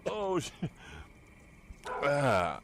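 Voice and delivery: ordinary talk, nothing marked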